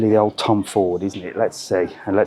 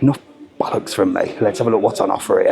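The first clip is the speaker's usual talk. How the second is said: ordinary talk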